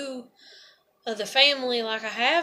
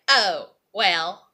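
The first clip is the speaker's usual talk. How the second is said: mocking shrug; 7 semitones lower than usual. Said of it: "she's just like".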